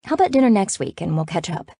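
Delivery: ordinary delivery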